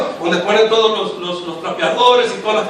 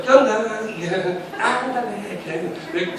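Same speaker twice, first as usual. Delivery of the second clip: softer, slow lightly put-on Mexican accent; Mexican slang talk; 2 semitones lower, 8 dB quieter, much slower than usual. Showing the little Mexican way of talking he picked up, light Mexico City accent. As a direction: drag out ándale the Mexico City way. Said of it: "del habladito".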